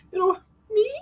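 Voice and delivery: high, questioning little voice imitating a viewer; mock surprised reaction. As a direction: imitate a bashful "who, me?". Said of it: "I love that little face it makes".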